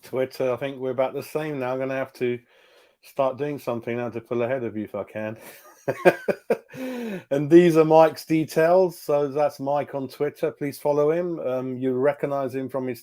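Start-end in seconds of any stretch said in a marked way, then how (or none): 5.9-7.2 a quick high chuckle; short real laugh. Real, not acted. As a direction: laugh it off at the rivalry. Laughing at friendly rivalry.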